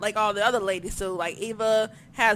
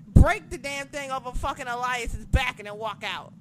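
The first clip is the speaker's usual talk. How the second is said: normal talking voice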